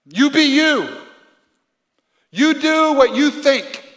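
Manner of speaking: lightly mocking you-do-you voice; mock slogan. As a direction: parrot the feel-good slogan. Mocking popular philosophy.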